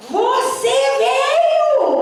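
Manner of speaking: high, drawn-out demonic greeting; demon's cry. Retold or imitated. Chief demon greeting the arriving pastor, drawn-out cry. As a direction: stretch it out high like a taunting demon. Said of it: "ele grita assim".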